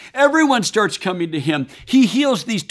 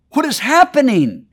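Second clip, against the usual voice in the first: higher and louder, astonished; a crowd that can't believe what it just saw; amazed crowd; 7 semitones higher, 5 dB louder than usual. Retold or imitated. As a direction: voice the stunned crowd.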